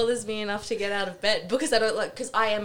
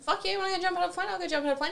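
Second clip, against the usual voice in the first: excited; 9 semitones higher, much faster than usual. Real, not acted. Hyped reply.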